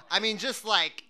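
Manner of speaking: ordinary delivery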